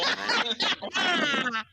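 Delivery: high falling villain cackle; evil laugh. Pretend. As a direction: cackle with menace. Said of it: "Le concours de rire maléfique". (rire maléfique aigu)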